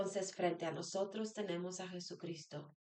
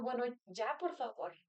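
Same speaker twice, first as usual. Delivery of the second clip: impatient plea; 4 semitones higher than usual. Retold or imitated. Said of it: "y digo yo".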